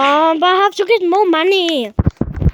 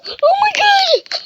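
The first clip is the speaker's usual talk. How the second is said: high laughing oh my god; laughing shriek; 13 semitones higher, slower than usual. Real, not acted. Gamer caught off guard, laughing shriek. Startled in game.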